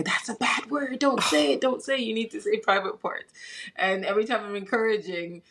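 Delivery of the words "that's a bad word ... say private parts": put-on scolding little kids. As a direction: imitate bossy six-year-olds telling her off. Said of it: "she has friends who are saying to her".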